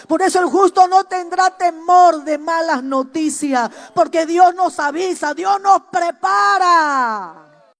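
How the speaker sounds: sermon at full force; preaching. Acted. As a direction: proclaim it to the church. Preaching to the congregation.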